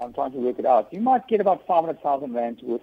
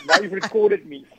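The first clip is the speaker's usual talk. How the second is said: laughing; 7 semitones higher than usual. Real, not acted. Laughing after being recorded.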